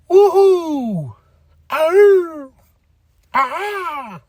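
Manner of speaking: high, joyful yelps; excited whoops. Real, not acted. Fan cheering the Berlin debut, high excited whoops. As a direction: let out happy cheers like a fan. Celebrating Trick's debut match.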